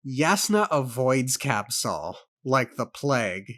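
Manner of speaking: ordinary delivery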